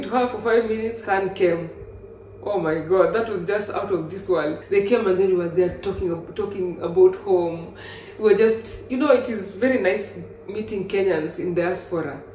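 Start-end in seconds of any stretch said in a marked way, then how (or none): none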